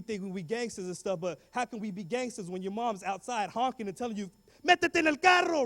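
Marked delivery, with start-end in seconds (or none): 4.6-5.6 high, loud Spanish mom shout; yelling mom. Retold or imitated. Mom honking outside school, yelling in Spanish. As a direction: call him into the car in front of everyone.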